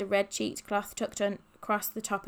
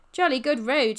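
bright call, higher and louder; shouting back; 4 semitones higher, 7 dB louder than usual. A narrator's reading. Polly to friends trailing on bikes, shouting back. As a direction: call back to those behind. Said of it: "shouted back Polly".

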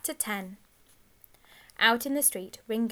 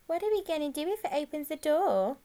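higher, put-on mocking-style echo; stalling echo in an altered, higher voice; echoing the question; 7 semitones higher, 3 dB louder than usual. A narrator's reading. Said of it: "Ringo echoed the question".

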